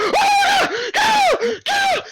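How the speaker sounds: very high, loud panicked shrieks; screaming. Acted. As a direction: shriek for help as the bear hangs on. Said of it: "the guy is still screaming".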